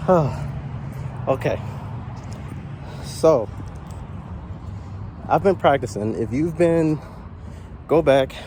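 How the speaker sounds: winded from walking; breathless, catching air; out of breath. Real, not acted. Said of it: "I'm out of breath".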